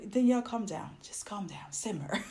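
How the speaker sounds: hushed self-soothing; calming down. Real, not acted. Vlogger reining in their own rant, hushed. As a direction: talk yourself down softly, ending in a breathy near-laugh.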